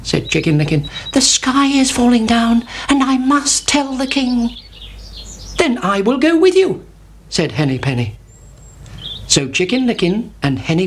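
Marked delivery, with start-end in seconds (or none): 1.1-4.5 higher, urgent little chick; Chicken Licken voice. A narrator's reading. 5.6-6.8 much higher, eager hen; Henny Penny offering to come, high and eager. A narrator's reading. Hen voiced in tale.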